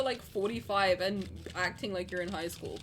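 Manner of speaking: ordinary talk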